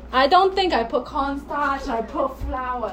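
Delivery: slow Hong Kong English; deliberate cooking talk in a Hong Kong accent. Shown as a demonstration. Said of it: "I do Hong Kong".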